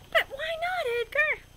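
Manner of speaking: high, whiny plea; pleading question. Acted. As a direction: coax him to explain.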